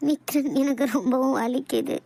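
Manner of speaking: slow, low pained moan; wife groaning to husband in pain. Acted. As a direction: drag the words out through the pain. Wife moaning in stomach pain.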